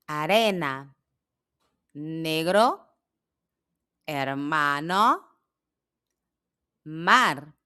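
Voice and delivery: each word alone with long pauses for learners; slow word drill; repeat-after-me list. Shown as a demonstration. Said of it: "para que possam repetir".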